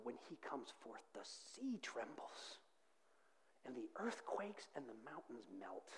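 soft, low, reverent delivery; hushed preaching. Real, not acted. Preacher describing a mighty yet gentle King, hushed. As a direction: drop right down to a tender hush.

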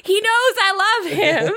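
high, amused squeaky take on the dad's line; mock dad, cracking up. Dad line redone while laughing.

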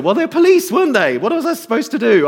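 high, fast, indignant friend; a hint of a flustered friend; friend's defensive reply. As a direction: pitch up and protest like the friend did. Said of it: "He said later".

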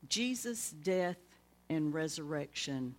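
normal talking voice

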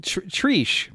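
"Trish" as a high "Treesh"; mimicked pronunciation. Co-host trying out the slip, "Trish" said as "Treesh". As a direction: echo "Trish" with a long ee.

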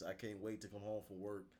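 ordinary delivery

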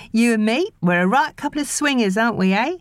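put-on high, jolly baby talk; sing-song it to the baby; nanny playing with the baby; playful nanny. A narrator's reading.

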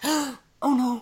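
gasp, then a high dismayed "oh no"; imitating a shocked moviegoer, pitch jumping up; retold gasping dismay. Moviegoer reliving the beat-up scene, gasping dismay. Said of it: "first time I ever made this noise".

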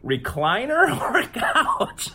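high, slow, laughing question; laughing disbelief. Real, not acted. Host hearing the co-host's topic, laughing in disbelief. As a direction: echo it back through laughter.

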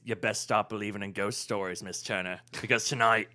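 low, ominous pirate-captain impression; Jeffrey Rush. Friend showing the line to the table, low Jeffrey Rush impression. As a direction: drop the pitch for a film villain's warning. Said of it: "you do a good Jeffrey Rush".

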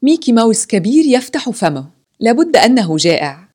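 lightly voiced children's glee; amused children. A narrator's reading. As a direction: exclaim it like delighted children. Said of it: "ضحك الأطفال وقالوا".

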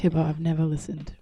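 normal talking voice

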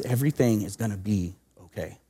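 put-on soft, hushed reassurance to a scared child; gentle comforting. Grandmother comforting crying child.

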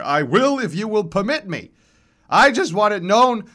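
high, loud, reedy protest; worked-up accented old man; indignant old man. A narrator's reading. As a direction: insist on your turn with wounded dignity. Old men squabbling on stage.

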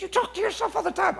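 high-pitched mock accusation; mimicking accusing coworkers. Coworkers accusing him of talking to himself, high mimicking voice. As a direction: squeal it like exasperated coworkers. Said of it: "They said".

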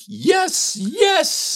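high-pitched squeal of joy; elated heart. Retold or imitated. Heart answering the call to start a school, elated squeal. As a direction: let the heart cry out, very high. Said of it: "my heart was like".

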